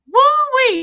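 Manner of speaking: high, loud hammed-up whoop; mock amazed whoop. Ticket guy reacts to Reese Bobby, exaggerated whoop.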